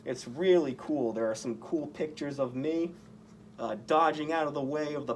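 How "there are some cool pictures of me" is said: ordinary delivery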